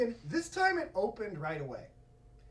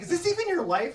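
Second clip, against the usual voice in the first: incredulous question; 9 semitones higher, 4 dB louder, faster than usual. Retold or imitated. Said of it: "She's like".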